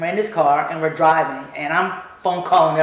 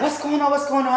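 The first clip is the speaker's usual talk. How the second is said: alarmed coworker; 9 semitones higher, faster than usual. Retold or imitated.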